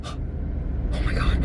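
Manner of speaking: slow shaken whisper; whispered fright. Retold or imitated. Just woken from the bad dream, a slow frightened whisper. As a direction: breathe it out, still scared. Jolting awake from the nightmare.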